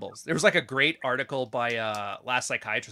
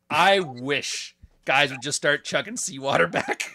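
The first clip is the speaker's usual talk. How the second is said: laughing; 3 semitones higher, slower than usual. Real, not acted. Laughing at absurd advice.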